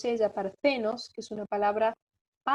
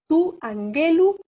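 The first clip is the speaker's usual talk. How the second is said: angelu stressed on GE, louder; accent moved along; 6 semitones higher, 7 dB louder, slower than usual. Shown as a demonstration. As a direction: say tu angelu with the accent on the middle syllable, an-GE-lu. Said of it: "corre un lugar el acento".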